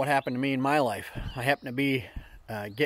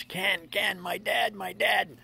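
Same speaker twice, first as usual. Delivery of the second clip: high, put-on distressed voice; mild mock anguish; imitated anguished plea; 8 semitones higher, much slower than usual. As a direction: plead like a hurting man.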